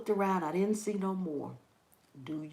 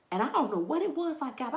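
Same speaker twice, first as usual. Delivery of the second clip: ordinary delivery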